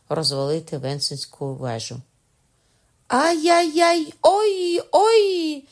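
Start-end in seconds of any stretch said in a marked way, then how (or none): 3.1-5.6 high, loud, drawn-out wail of pain; tortured prisoner howling theatrically in pain; hammed-up pain cry. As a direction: overplay the agony of a fake torture victim. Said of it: "закричав Кокона на всі лади".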